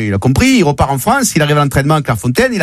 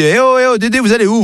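higher-pitched "hey you" call; calling after someone; 7 semitones higher than usual. Acted. Boss catching up with departing employee, calling out high. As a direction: hail someone who is walking off.

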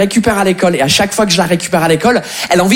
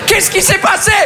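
high, mock-outraged shout; indignant outburst; 11 semitones higher than usual. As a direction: demand an explanation in comic exasperation. Indignant foreigner confronting French audience.

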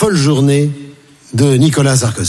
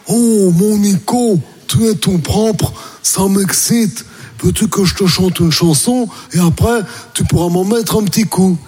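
suave; 4 semitones higher than usual. Acted. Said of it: "lui demande suavement".

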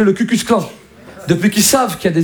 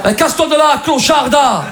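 mock-angry Marseille brush-off; shouted insult; 6 semitones higher than usual. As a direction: yell it after someone who turned you down. Acted insult after rejected flirting.

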